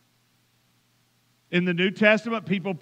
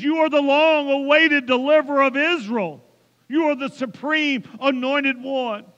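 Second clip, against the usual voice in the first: preaching at full force; 4 semitones higher than usual. Acted. Pastor proclaiming Peter's confession to the church, full-force preaching. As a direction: thunder out each title as a rising proclamation.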